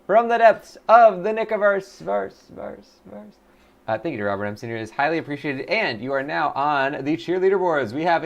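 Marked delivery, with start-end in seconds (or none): 0.1-3.4 big voice fading into echo. Acted. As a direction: announce it, then echo away.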